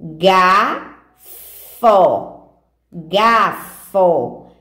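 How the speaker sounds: slow teacher reading, pausing between GA and FO in "Gafo"; leitura silabada. Shown as a demonstration. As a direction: read GAFO syllable by syllable, GA... FO. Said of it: "A primeira palavrinha é essa aqui". (leitura pausada, sílaba por sílaba)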